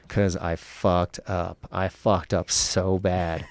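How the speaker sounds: very slow, drawn-out self-reproach; slow regret. Retold or imitated. Berating himself after the interview, slow and regretful. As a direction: drag it out as the mistake sinks in.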